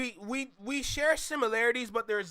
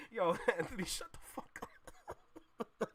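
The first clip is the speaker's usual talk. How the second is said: laughing whisper; 8 dB quieter than usual. Real, not acted. Laughing at Anthony's joke.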